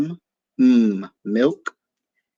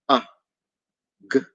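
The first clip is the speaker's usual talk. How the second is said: normal talking voice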